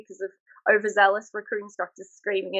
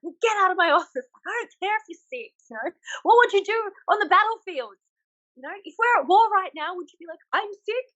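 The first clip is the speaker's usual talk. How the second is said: higher, mocking drill-instructor scold; mock harsh instructor; 9 semitones higher than usual. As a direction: tear into a recruit for calling in sick, hammed up. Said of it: "and I'm like".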